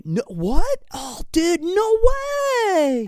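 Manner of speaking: high, rising dude-bro whine; mock clueless dude. Eric pretending he got dumped, squeaky baffled dude voice. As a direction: play him baffled and squeaky. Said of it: "And he's like".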